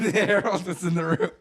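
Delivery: loud, high, drawn-out words through laughter; laughing. Real, not acted. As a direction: crack up while saying it. Laughing at the joke.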